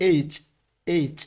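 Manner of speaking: letter name এইচ said clearly; pronounced aitch. Shown as a demonstration. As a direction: say এইচ as "ay-ch", ending on a ch sound. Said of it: "এইভাবে উচ্চারণ করি".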